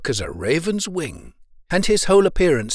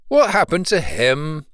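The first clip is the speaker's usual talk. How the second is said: plain reading voice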